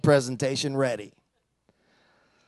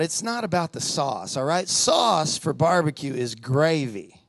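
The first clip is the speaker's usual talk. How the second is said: usual speaking voice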